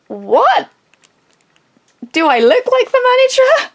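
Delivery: high, loud disbelieving retort; incredulous. Retold or imitated. Retelling her reply to the customer, incredulous and high. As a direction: sound utterly baffled at the question.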